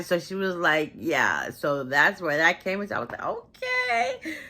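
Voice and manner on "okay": very high, drawn-out cheer; impressed hype. Real, not acted. Cheering his standing up to father, impressed squeal. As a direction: squeal it in approval, pitch way up.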